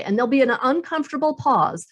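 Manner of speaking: ordinary talk, nothing marked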